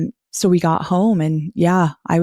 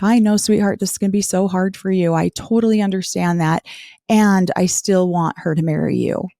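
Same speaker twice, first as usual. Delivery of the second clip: ordinary talk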